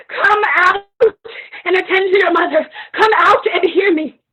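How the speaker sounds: high, loud call through sobs, rushed; crying summons; tearful calling. Acted. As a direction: beg her child to come out, weeping. Mother crying out to daughter.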